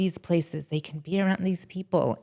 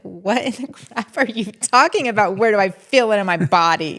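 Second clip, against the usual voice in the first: incredulous outburst; 3 semitones higher, 10 dB louder than usual. Retold or imitated. Telling the podcast host how she reacted in trauma training, loud and incredulous. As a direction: blurt it out like you can't believe the question. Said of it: "And I'm like".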